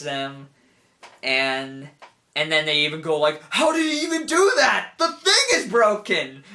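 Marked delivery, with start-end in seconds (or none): 3.5-6.4 high, loud mock outrage; incredulous shout. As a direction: shout in disbelief like a stunned villain. Acting out stunned enemies shouting.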